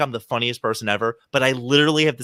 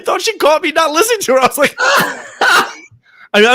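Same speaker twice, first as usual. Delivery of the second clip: laughing; 13 semitones higher, 6 dB louder than usual. Real, not acted. Laughs recalling the mix-up.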